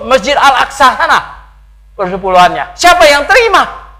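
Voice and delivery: loud, high-pitched challenge from the pulpit; full-force preaching. Real, not acted. Preacher challenges the congregation on tithing today, full-force preaching. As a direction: hammer the rhetorical questions home loudly.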